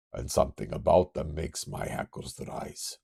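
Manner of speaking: put-on retry it with a Russian accent. Kurt senses aircraft, Russian accent retake. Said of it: "Do I not have a Russian accent".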